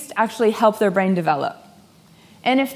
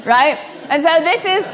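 normal talking voice

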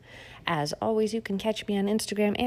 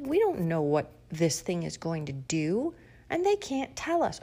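usual speaking voice

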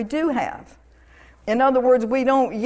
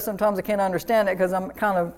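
ordinary delivery

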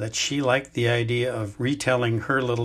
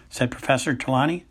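ordinary delivery

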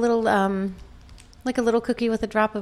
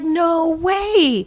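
amazed; 8 semitones higher, 6 dB louder than usual. Retold or imitated. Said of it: "I was like".

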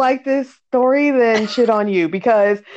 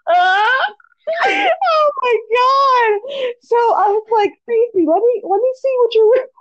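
high, wheezing laughter through words; laughing so hard tears come; crying with laughter; 9 semitones higher than usual. Real, not acted. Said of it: "I'm crying over here".

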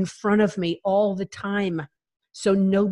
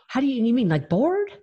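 higher-pitched baffled question; puzzled mom; 6 semitones higher than usual. Retold or imitated. Mom who never knew depression asks daughter, puzzled. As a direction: imitate an uncomprehending mother.

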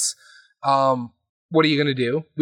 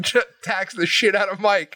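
ordinary talk, nothing marked

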